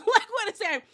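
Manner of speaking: laughing high and fast. Real, not acted. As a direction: laugh through the question. Laughs at the drama.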